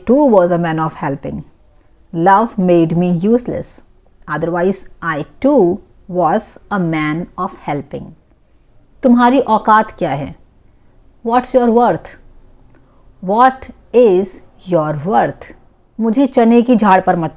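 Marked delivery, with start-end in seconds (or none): 2.1-8.0 a bit slower, words laid out clearly; unhurried teaching repeat. Shown as a demonstration. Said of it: "teacher repeats slowly for learners". 13.2-15.5 slowed-down repeat, each word separate; teacher's slow dictation pace; slow and clear. Shown as a demonstration. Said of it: "teacher repeats slowly for learners".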